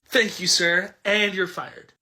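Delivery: usual speaking voice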